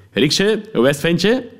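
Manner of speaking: high, hearty cop's hello; friendly greeting. Acted. Policeman greets the narrator from the car, friendly. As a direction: greet him like an old pal. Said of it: "begroette mij vriendelijk".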